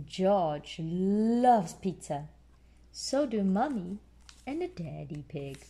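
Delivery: usual speaking voice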